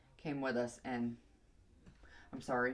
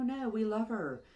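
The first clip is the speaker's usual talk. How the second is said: emphatic; 8 semitones higher, 5 dB louder than usual. Retold or imitated. Caller refusing to return dog.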